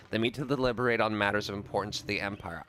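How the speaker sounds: ordinary talk, nothing marked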